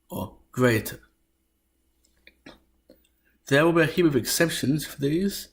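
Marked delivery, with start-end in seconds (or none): 0.5-1.0 "greater" as two syllables, the final E of grete sounded; Middle English pronunciation. Shown as a demonstration. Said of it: "it should be pronounced as".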